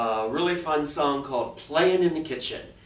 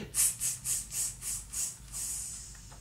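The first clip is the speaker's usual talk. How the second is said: soft voiceless monkey "tss" noises; monkey chatter; 15 dB quieter, slower than usual. Acted. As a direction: hiss short tss sounds like mimicking monkeys. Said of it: "monkeys only stamped both their feet back at him and said".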